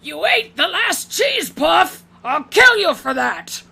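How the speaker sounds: put-on high, loud furious shout of an old orc; furious old man yell. Old orc screaming at a comrade over cheese puffs, high furious yell. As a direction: scream it like murder over a snack. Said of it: "an old man's voice just going".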